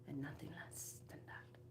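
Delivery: faint breathy whisper. Shown as a demonstration. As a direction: whisper the vow almost silently. Throne affirmation.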